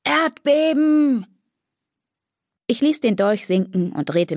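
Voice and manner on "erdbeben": high, drawn-out shout of alarm; shouted warning. A narrator's reading. Someone upstairs yelling a warning to the house, drawn-out shout. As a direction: call the word out up the stairwell. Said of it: "jemand brüllte ein einziges Wort".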